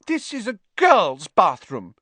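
high, loud suspicious objection; suspicious ghost girl, pitched well up. A narrator's reading. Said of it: "eyeing Ron and Harry suspiciously".

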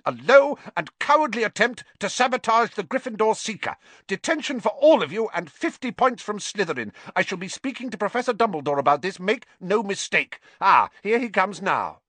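high, loud stern tirade; angry scolding. A narrator's reading. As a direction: dress down the culprits sharply. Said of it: "she was shouting".

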